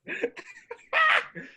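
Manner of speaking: a sudden high burst of laughter; cracking up at his own joke, high laugh; high squealing laugh. Real, not acted. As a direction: let out a squeaky cackle.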